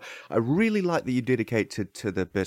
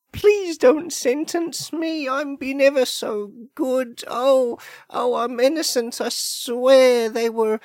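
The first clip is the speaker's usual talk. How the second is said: very high, whiny begging voice; pleading; 11 semitones higher than usual. Acted. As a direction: beg in a high wail. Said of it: "some good pleading right there".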